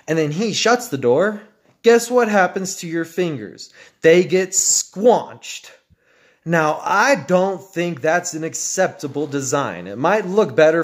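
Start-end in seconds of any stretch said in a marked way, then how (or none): 4.0-5.7 slow, stretched squanched; drawn-out punchline. Real, not acted. Warning viewers about crushed fingers, slow and drawn out. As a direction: linger on squanched.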